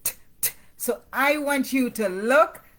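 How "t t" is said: crisp unvoiced t sound repeated; letter T sound. Shown as a demonstration. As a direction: tap out a clean t, t. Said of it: "T says".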